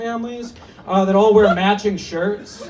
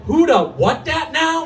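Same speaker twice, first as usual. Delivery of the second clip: put-on confused kid; 7 semitones higher, slower than usual. Thirteen-year-old self confused.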